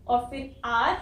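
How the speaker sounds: ordinary talk, nothing marked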